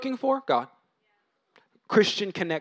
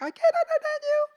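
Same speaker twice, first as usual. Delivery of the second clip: much higher mock whine with a laugh; high-pitched voice. Preacher imitating a sulker's squeaky voice, much higher. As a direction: squeak it out in mock self-pity. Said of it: "a high-pitched voice where you're like".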